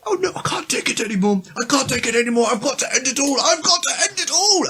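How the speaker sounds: high-pitched melodramatic despair; despairing cartoon leaf. Retelling the sketch of suicidal leaves, hammed-up high despair. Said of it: "And then you just hear".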